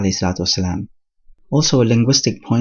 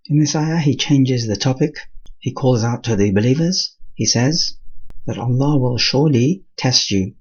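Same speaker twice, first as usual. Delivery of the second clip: ordinary talk, nothing marked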